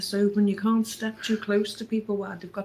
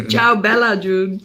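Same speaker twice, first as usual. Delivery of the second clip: mock Italian; 4 semitones higher, 10 dB louder than usual.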